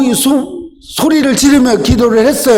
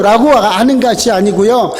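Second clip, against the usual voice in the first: ordinary talk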